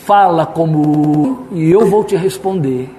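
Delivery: slow, weighty divine challenge; God. Retold or imitated. God answering Job, slow commanding voice. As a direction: draw it out as a grand command. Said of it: "ele chega pra Jó e ele diz".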